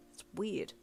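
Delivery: flustered, self-conscious grumble; embarrassed huff. A narrator's reading. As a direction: mumble the complaint, embarrassed. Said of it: "you huffed with embarrassment".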